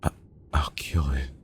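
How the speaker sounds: halting, breath-only whisper; weak whisper. A narrator's reading. Wounded Milo vowing to kill it, whispering. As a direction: force it out in a faint whisper. Wounded Milo's last words.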